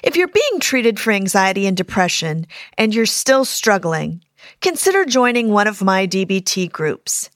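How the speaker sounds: loud, punchy podcast ad read; promo read. Acted. Therapist plugging her skills groups to podcast listeners, loud promo read. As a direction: pitch it loudly to the listeners.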